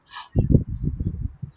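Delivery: big, loud gasp of pretend amazement; mock surprised gasp. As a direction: gasp as if thrilled by the answer. Teacher reacts to guessed answer.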